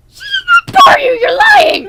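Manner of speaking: high, very loud contradiction; indignant high-pitched shouting; shouted accusation. Real, not acted. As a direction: yell it in outrage. Accusing partner of lying.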